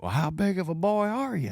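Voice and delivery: high-pitched mock taunt down the phone; raising Cain. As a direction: imitate an irate man picking a fight. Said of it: "he's raising Cain on the other end".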